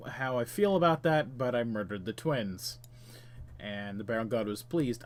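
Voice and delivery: ordinary talk, nothing marked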